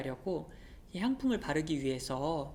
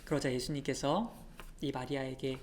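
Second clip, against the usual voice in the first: ordinary delivery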